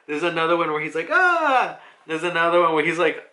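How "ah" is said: high, loud imitated yelp; mock startled yell. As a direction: yell out as if dodging a blow. Acting a dodger's cry.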